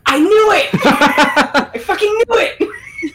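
high-pitched gleeful shriek through laughter; excited, laughing outcry. Real, not acted. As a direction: squeal it in triumph, breaking into laughter. Guest player revealed, delighted outcry.

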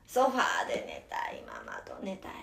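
put-on whispered child's plea; sleepy whisper. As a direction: breathe it like a drowsy kid.

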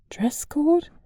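whispered, high and startled; slightly panicked. A narrator's reading. Girl hears of a dress code, panicked whisper. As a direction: breathe the word out in sudden alarm. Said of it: "sah sie leicht panisch an".

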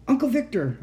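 high, urgent shout for attention. A narrator's reading. Trapped child calls to the uncle, shouting. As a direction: call out sharply, pitched right up. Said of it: "I shouted".

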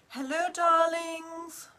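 campy drag queen. Acted. Campy livestream greeting.

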